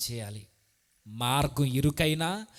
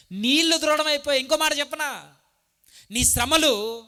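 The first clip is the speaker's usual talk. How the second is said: నీళ్లు దృఢమైపోయాయి said much higher and louder; loud proclamation; 10 semitones higher, 6 dB louder than usual. Shown as a demonstration. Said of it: "repeated phrase". (నీళ్లు దృఢమైపోయాయి ఎత్తైన గొంతుతో గట్టిగా ప్రకటించడం)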